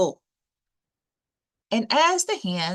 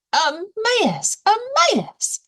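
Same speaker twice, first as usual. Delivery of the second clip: high, fussy squawk of a hen; a second hen echoing in alarm; hen crying out; 4 dB louder than usual. Acted. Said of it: "cried the other".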